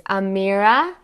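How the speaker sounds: loud, rising call of the name; a sharp, questioning check from a strict aunt. A narrator's reading. Aunt cutting in on her niece, strict. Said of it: "I could recognize my aunt's strict tone anywhere".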